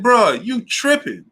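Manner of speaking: high-pitched imitation of his friend, drawn out; high mock voice of a worried friend; mimicking his boy. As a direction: squeak it out like a scolding buddy. Friend's reaction voiced in story.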